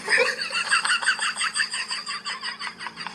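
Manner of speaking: high-pitched burst of laughter after the punchline. Real, not acted. Joke teller laughing at the fainting doctor, high squeaky laugh. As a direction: let out a squeaky laugh at your own joke.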